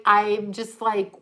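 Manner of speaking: ordinary delivery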